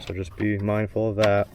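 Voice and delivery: ordinary talk, nothing marked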